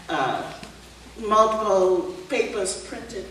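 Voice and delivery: usual speaking voice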